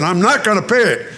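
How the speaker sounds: digging in against the clerk; mock indignant refusal. Refusing the clerk's late fee.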